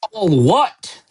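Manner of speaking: thundered, high-pitched outraged question; Hagrid. A narrator's reading. Giant outraged at Harry's ignorance, thundering. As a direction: boom it back in disbelief. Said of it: "Hagrid thundered".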